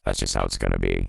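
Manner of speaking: robotic vocoder voice dropped deep; deep pitch shifted. Shown as a demonstration. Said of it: "Minus fourteen semitones".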